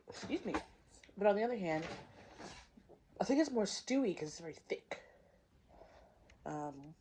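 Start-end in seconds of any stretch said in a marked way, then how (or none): none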